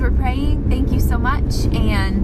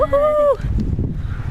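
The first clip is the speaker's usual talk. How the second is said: a high, delighted whoop; cheering. Real, not acted. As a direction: let out a happy cheer. Heading into the ultrasound, cheering.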